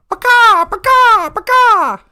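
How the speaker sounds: loud, high, repeated hen squawks; chicken squawk. Acted. Storyteller mimics the chicken heard from the coop, squawking loudly. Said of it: "There was a sound of a".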